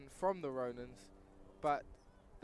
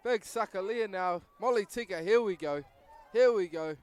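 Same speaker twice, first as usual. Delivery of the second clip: excited commentary; 4 semitones higher, 9 dB louder than usual. Real, not acted. Live try-line play-by-play.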